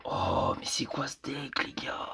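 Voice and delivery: whispered, slow and stunned; hushed can't-believe-it; disbelieving whisper. Real, not acted. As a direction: breathe it out in disbelief. Stunned by opponent's deck.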